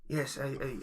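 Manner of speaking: ordinary talk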